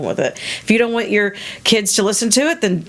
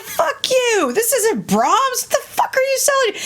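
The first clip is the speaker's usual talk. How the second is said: high, squeaky mock outrage; mock outraged nerd. Outraged classical fans cursing the fake Brahms, high mock fury. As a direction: squeal it like a scandalized snob.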